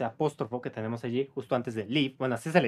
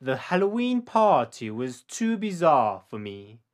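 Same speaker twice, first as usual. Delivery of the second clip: slow, careful read for repeating; each word laid out slowly for the learner; slow model sentence; slower than usual. Shown as a demonstration. Teacher to student, slow model sentence for repetition.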